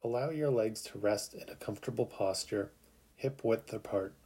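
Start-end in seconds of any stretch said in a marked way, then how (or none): none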